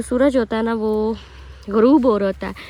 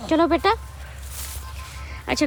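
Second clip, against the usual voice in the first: normal talking voice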